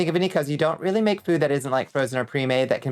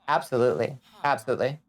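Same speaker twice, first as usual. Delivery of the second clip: usual speaking voice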